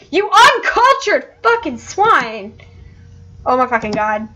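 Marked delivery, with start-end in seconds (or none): 0.1-2.6 high, slow, drawn-out scolding; hammed-up indignation, stretching each word; mock outrage. Dog scolding heaven's dog over Wi-Fi, mock outraged.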